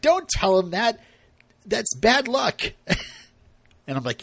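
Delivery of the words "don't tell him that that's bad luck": mock-alarmed retort in a higher pitch; acted Caesar objection. Caesar objects to Luke's promise, acted high-pitched protest. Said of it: "And Caesar's like".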